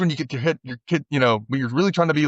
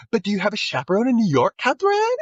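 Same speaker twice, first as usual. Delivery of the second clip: high, quick imitated Valley Girl; Valley Girl accent; 9 semitones higher than usual. Prank caller quizzing the winner, mock Valley Girl accent. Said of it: "she puts on that Valley Girl accent".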